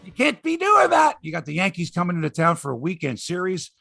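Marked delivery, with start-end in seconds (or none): 0.0-1.2 much higher, louder shout at the team; outraged shout. Real, not acted. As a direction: yell your disbelief at the loss. Ranting about Blue Jays loss.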